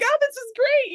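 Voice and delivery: high, loud happy gush; gushing delight. Acted. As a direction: gush over an unexpected treat. Mom thrilled at offered hug.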